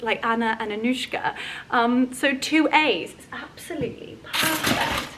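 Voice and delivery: normal talking voice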